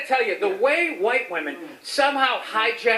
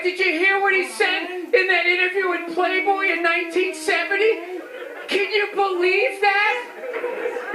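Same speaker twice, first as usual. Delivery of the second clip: higher-pitched imitation of the scandalized; mock outrage; 6 semitones higher than usual. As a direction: mimic people up in arms, slowing at the end. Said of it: "They're all up in arms".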